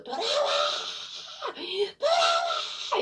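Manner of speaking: piercingly high, very drawn-out cry; imitated woman's wailing. Unknown woman calling the president back, imitated anguished high wail. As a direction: wail the plea like a woman crying out. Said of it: "이러면서 울부짖는 그 소리".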